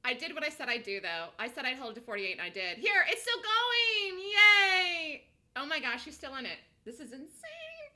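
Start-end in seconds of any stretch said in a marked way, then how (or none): 2.8-5.2 slow, high, loud cry of delight; excited shout. Real, not acted. Stock keeps rising, shouting. 6.8-7.9 slow, high squeal of disbelief; stunned squeal. Real, not acted.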